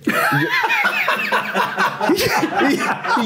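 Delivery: giggling high laugh; high burst of laughter. Real, not acted. Recalls awkward moment, bursts laughing.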